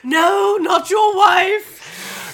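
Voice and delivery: high, loud mock-royal cry; the Queen. The Queen reassuring Harry about her victim, drawn-out high royal cry. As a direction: drag it out in regal falsetto. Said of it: "I'm being the queen".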